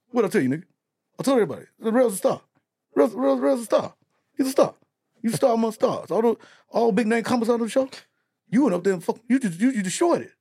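high, excited praise; excited hype. Retold or imitated. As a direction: hype him up like a proud manager. Charlie Mack quoted hyping.

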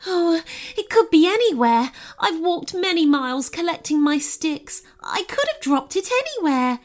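high, worried goblin voice; anxious storybook goblin, pitched high; fretting goblin. A narrator's reading. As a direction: fret aloud in a high, flustered voice. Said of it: "fretted the goblin".

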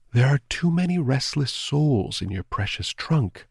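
a lightly voiced dead girl; ghost girl. A narrator's reading. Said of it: "the round-eyed hapless girl said".

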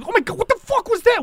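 very high, panicked exclamation; shocked outburst. Acted. Onlooker reacts to the stabbing, high panic. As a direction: squeal it in horrified disbelief.

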